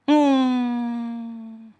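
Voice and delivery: mock buzzing hum, held; bee buzz. Preacher mimics a bee's buzz for the church. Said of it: "Itulah bahasa lebah".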